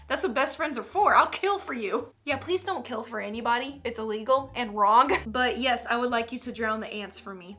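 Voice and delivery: ordinary talk, nothing marked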